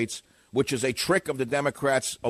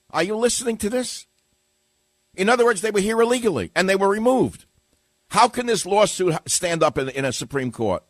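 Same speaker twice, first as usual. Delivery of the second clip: loud, high indignant ranting; raised, exasperated talk-radio rant; indignant outburst; 7 semitones higher, 5 dB louder than usual. Real, not acted. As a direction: demand the listener pay attention. Host rants indignantly at listeners.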